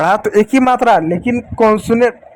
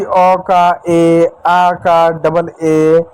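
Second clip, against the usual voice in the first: slow spelling out; 3 semitones lower, much slower than usual. Shown as a demonstration. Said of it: "जिसमें आप पढ़ते हो ना".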